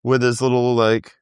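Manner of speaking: normal talking voice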